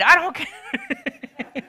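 slow stubborn reply. Retold or imitated. Brother brushing off his complaint, slow stubborn reply. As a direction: stretch every word, refusing to budge.

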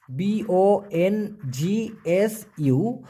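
slow letter-by-letter spelling of বংশু. Shown as a demonstration. Teacher spelling বংশু for the class, slowly. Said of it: "উচ্চারণটা হবে".

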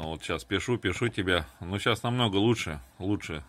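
normal talking voice

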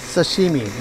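usual speaking voice

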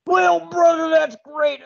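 over-the-top wrestler promo; Macho Man impression. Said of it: "gonna do a bad Macho Man impression".

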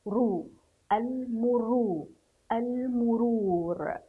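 المرور drawn out slowly with a long "ruu"; damma on the ra. Shown as a demonstration. Teacher pronounces ra with damma for pupils, slow demo. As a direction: sound out رو, then stretch المرور. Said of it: "سأنطق حرف الراء ولكن بالضم".

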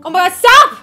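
loud, alarmed plea to stop; startled outcry. Real, not acted. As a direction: cry out in sudden alarm. Startled by the horror game.

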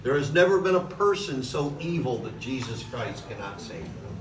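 ordinary talk

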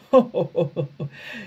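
hearty good-natured laugh; Duck laughs. Acted. Duck laughs at the joke, good-natured. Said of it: "Then Duck laughed too".

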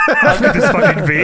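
high, loud, through laughter; laughing mock disbelief. Host mocking the wrestlers' confusion to his co-host, laughing disbelief. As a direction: crack up while acting baffled. Mocks the outsiders' bafflement over four.

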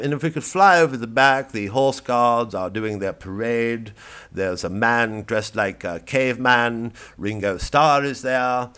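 mock live news reporter; live chopper report. Chopper reporter over Buckingham Palace describing the crowd live. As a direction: narrate the crowd below like breaking news.

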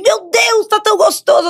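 put-on high drunk gushing; tipsy gushing. Telling the hosts how drunk she got, high tipsy gush. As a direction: imitate her tipsy self raving, high pitched. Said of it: "eu tô vermelha assim".

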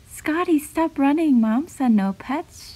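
usual speaking voice

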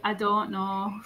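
long-vowelled northern English "don't know"; northern English accent. Shown as a demonstration. Contrasting own speech with northerners', northern English accent. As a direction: do the northern English version of the phrase. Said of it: "They say".

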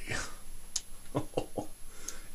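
brief, quiet, breathy chuckle; little airy laugh; soft chuckle. Acted. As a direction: chuckle under your breath. Chuckles after old idiom.

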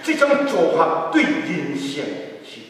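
ordinary talk

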